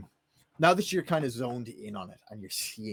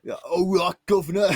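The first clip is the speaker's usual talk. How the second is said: higher, louder, sing-song Cockney butcher voice; a cheeky London butcher greeting the governor; 7 semitones higher, 10 dB louder than usual. Acted. [name] answering the chat's request in a loud Cockney butcher voice. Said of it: "a butcher's voice".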